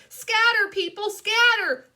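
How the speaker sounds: high, loud panicked warning; yelled. Acted. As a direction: yell for everyone to flee. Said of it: "I yelled".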